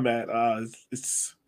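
ordinary delivery

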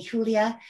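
[name] with an H sound for J; corrected Spanish pronunciation. Real, not acted. Interviewer apologizing for the name, [name] said "HOO-lia". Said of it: "pardon me".

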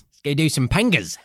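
Aussie bloke voice, drawled; comic Australian accent; mock Australian. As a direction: echo it in a hammed-up Australian accent. Echoing in Australian voice.

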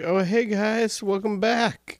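high, cheery mock soldier greeting visitors; clueless friendly greeting. Acting unsuspecting Americans greeting.

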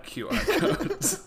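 laughing through the words; can't keep a straight face. Real, not acted. Joking with a cohost about a horror film, laughing through it.

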